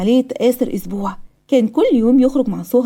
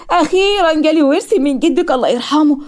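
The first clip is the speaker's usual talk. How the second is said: higher-pitched, drawn-out delight; overjoyed; 6 semitones higher, 4 dB louder than usual. A narrator's reading. Mother telling her daughter of an inheritance, overjoyed. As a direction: voice the good news with happy relief. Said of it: "والدتها فرحانه قوي".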